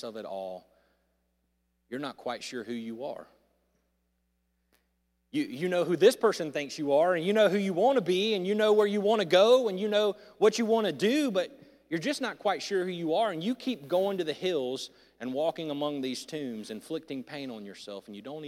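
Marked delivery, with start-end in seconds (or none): 5.3-13.1 building sermon appeal. Acted. Rising appeal to listeners.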